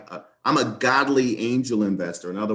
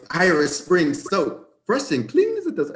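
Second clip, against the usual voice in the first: mock Irish leprechaun accent; 7 semitones higher than usual. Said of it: "he sounds like a leprechaun".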